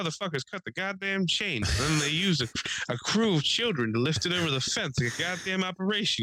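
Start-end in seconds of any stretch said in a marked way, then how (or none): 0.0-1.6 put-on mildly cranky, higher old-man voice; grumbling old man. Old neighbor finding the chain cut, a touch indignant. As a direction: lightly imitate an outraged old-timer. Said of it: "he's how he talked".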